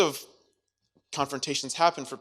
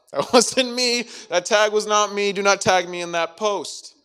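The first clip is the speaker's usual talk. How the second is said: higher, louder protest with a laugh; mock panicked denial, higher and louder; loud frantic denial; 6 semitones higher, 6 dB louder than usual. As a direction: deny it loudly, flustered. Acted Peter loudly denying.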